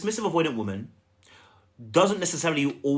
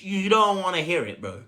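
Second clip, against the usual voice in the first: normal talking voice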